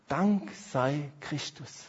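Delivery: soft, slow thanksgiving; quiet grateful thanks. Acted. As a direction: breathe it out as relief. Paul's grateful answer. (leiser, langsamer Dank)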